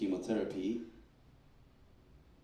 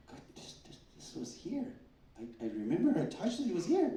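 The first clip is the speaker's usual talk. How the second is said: surprised disbelief; 10 semitones higher than usual. Retold or imitated. Doctor shocked lump is gone.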